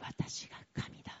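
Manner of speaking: hushed whisper. Retold or imitated. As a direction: whisper it softly. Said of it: "神様は言います".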